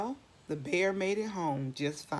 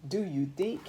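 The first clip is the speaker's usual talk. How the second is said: ordinary delivery, nothing marked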